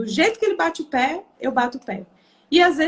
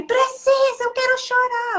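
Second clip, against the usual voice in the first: very high-pitched mock wail; crying child; 11 semitones higher than usual. Son answering his mother that he must cry, wailing. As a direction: imitate a small boy insisting through a tantrum. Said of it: "às vezes eu falo assim".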